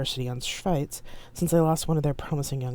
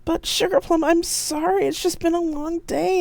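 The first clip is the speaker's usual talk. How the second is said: higher, pleading call; yelling after; 4 dB louder than usual. A narrator's reading. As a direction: beg after him across the crowd. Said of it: "I yelled after him".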